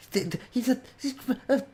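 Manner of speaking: overexcited little dog, tripping over words; excited stammer. Acted. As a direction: sputter it out in a frenzy. Puppies losing it, stammering.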